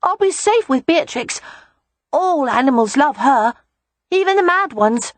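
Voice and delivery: put-on high, assured child voice; small boy reassuring his father; confident little boy. A narrator's reading. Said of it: "Rye said confidently".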